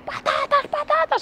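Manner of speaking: very high, mock toddler screech; screaming child. Child on the supermarket floor screaming for potatoes. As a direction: squeal it like a child throwing a tantrum. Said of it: "se pone a gritar".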